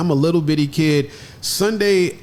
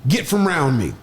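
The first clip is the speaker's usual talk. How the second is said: imitating grandmother's Oklahoma accent; Oklahoma style. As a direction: say it the Oklahoma way, as a retold order. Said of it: "she said it Oklahoma style".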